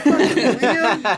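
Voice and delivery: laughing mock yell, slow; mock shout. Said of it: "We're like".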